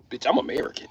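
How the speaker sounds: softly stated, under the breath; low-key retort. Acted. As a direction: toss it out quietly, rising at the end. Same line said low first.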